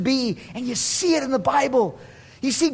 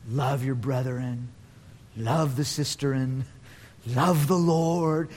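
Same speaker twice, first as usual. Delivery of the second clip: low, slow, drawn-out recital; gentle preachy refrain; 10 semitones lower, 6 dB quieter, slower than usual. Acted. John writing to the church, gentle preachy refrain. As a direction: intone each phrase like a tender repeated motto. Voicing John's repetitive message of love.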